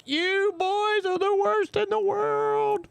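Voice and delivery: high, slow scolding aunt voice. Retold or imitated. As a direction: scold the boys like a shocked aunt, high and drawn out. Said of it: "my aunt's like".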